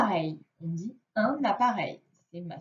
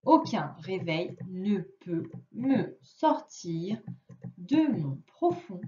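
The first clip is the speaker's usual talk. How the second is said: put-on slow, careful reading for a child; slow read-aloud; 4 semitones lower, slower than usual. Shown as a demonstration. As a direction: read each word slowly for a young pupil. Reads the sentences aloud. (lecture lente et posée pour un enfant)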